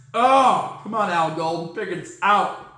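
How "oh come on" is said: normal talking voice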